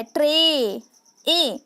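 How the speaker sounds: ट्रे cut before the n, vowel held, then ए alone; isolated A sound. Shown as a demonstration. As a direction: stretch the "ay" in ट्रे and echo it as ए. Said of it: "ए का sound आ रहा है".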